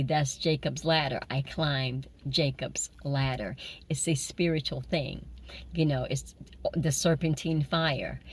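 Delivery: whispered, intimate preaching; low whispered sermon, voice barely there; hushed whisper. Acted. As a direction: breathe the revelation like a secret. Switches to a hushed mystical teaching.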